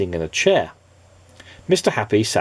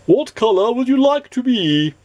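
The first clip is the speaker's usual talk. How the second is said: much higher quavery wizard voice; jolly wizard; 13 semitones higher, 4 dB louder than usual. Acted. The wizard asking Mister Nobody, high wizard voice. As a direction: ask like a fussy old wizard.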